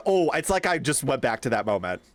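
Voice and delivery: ordinary talk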